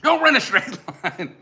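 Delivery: high, loud, drawn-out call through laughter; joking holler. Pretend. Co-host calling advice to the fleeing friend, hollering. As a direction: yell it after someone running away.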